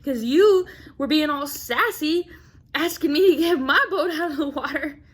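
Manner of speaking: high, loud mock indignation; imitating an indignant, sassy complaint; mad. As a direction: rant in hammed-up outrage with a laughing wobble. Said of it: "he was mad about it".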